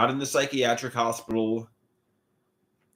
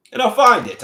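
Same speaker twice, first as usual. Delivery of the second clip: emphatic; 9 semitones higher, 8 dB louder than usual. Real, not acted. Self-affirming about finding love.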